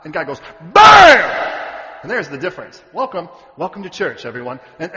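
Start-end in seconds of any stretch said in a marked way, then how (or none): 0.7-2.0 huge, high shouted blast; explosive impact shout. Acted. God strikes back at Satan's taunts, explosive shout. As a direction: shout it like a sudden heavy blow. God's blow, voiced as impact.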